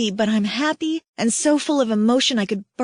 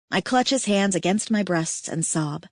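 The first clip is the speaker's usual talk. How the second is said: usual speaking voice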